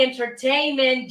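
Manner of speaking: ordinary talk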